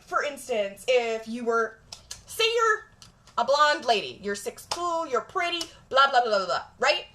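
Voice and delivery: normal talking voice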